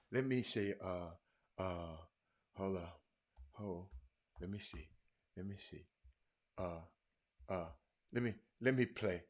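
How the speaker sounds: very soft, low, halting mumble; distracted, hushed self-talk; muttering while searching. Real, not acted. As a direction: talk to yourself under your breath while hunting for something. Searching for a song to play.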